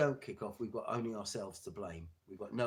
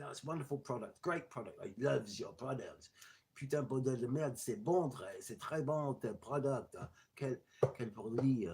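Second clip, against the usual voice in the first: imitating a gushing French buyer, slightly raised pitch; French accent; 2 semitones higher than usual. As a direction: put on a French accent and lay on the praise. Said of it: "French buyer".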